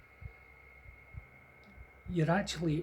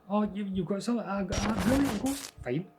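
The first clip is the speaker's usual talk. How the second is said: higher, breezy unbothered mum; blasé mum; 6 semitones higher, 4 dB louder than usual. Retold or imitated. Mother unbothered by ghost talk, breezy and casual. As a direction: shrug it off like mum. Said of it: "Sort of thing like".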